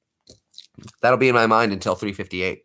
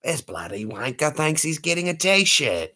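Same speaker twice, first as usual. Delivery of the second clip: mock Aussie accent; 5 semitones higher than usual. Mock rival Aussie bloke.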